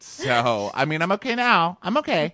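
high, loud, flustered mock voice; mocking whiny girl. Mocking the girl confronting Wells, high and flustered. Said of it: "And she goes".

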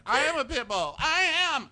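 hammed-up high whiny insistence; mock Chase. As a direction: wail it like an emotional young man. Chase voiced in recap.

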